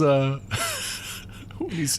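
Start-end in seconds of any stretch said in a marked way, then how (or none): 0.4-1.2 tiny, very high mock scream; soft high squeal imitating dying fairies; little death scream. Host imitating the fairies Hoggle sprays, tiny death scream. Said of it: "their little death screams".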